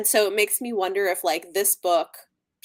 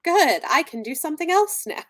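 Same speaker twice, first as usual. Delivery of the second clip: mock cheerful kid; 7 semitones higher, 4 dB louder than usual. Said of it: "the kid's like".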